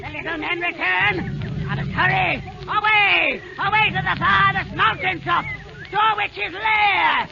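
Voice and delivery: high, fast urgent hag; frantic wicked witch. Acted. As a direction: hurry off taunting. Witch fleeing.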